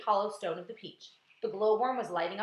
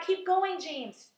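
high, loud urging, easing on the name; crying out; 10 semitones higher, 5 dB louder, much slower than usual. A narrator's reading. Crew urging James on, crying out. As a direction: egg someone on with a high shout. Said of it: "they cried".

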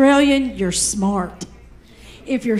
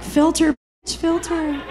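sassy aside; 4 semitones higher, 4 dB quieter than usual. Retold or imitated.

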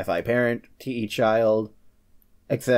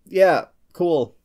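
ordinary talk

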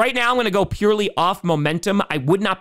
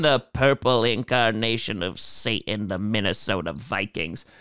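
much lower and softer, a mock demon growl; demonic voice; 8 semitones lower, 4 dB quieter than usual. Calling the Vikings Satan in a mock demonic voice. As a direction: sink into a hammed-up devilish rumble.

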